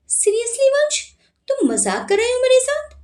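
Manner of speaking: disbelief, as if he can't be serious; astonished. Acted. Sanjana asking Vansh if he's joking, high and incredulous. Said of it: "Sanjana हैरानी से बोली".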